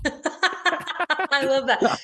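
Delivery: slow laugh on the words; chuckling delight. Real, not acted. Laughs at eager offer.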